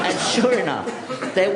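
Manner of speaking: high, slow words through a laugh; amused, laughing into the sea lion story; laughing while talking. Real, not acted. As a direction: still laughing as you go on.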